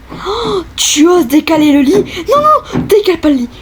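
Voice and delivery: squeaky indignant little voice; high character voice. Acted. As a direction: play an outraged tiny character. Voices a Sim moving the bed. (petite voix indignée)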